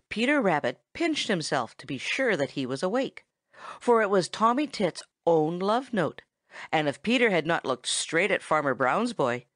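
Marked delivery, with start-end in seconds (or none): none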